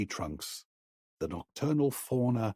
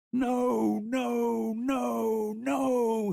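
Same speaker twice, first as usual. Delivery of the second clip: high, loud, sustained sobbing chant; keen each no on one high held note; sobbing intoned no; 14 semitones higher, 4 dB louder, much slower than usual. A narrator's reading. Boy sobbing over his dead friend, keening no. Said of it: "he intoned, sobbing".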